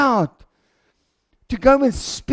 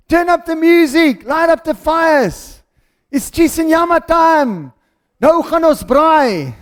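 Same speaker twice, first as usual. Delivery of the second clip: loud, high celebratory shout; jubilant party call; 5 semitones higher, 5 dB louder than usual. Acted. Father calling everyone to a party for his son, jubilant.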